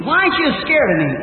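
high-pitched, retold challenge; challenging question. Hunter questioning a fearless eagle, challenging. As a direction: ask it high, as if daring the bird.

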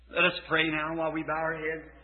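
soft, low invitation to pray; altar call. Real, not acted. Preacher inviting sinners to pray, soft and low. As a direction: gently call them to raise hands.

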